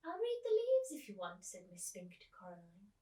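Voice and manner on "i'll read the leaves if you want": put-on high, sing-song old lady; high old actress offering to read leaves. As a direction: play the fussy old Miss Spink. Teacher voicing old Miss Spink.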